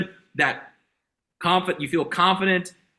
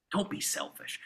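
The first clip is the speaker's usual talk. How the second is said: whisper; 13 dB quieter than usual. Real, not acted. Aside to the sales team.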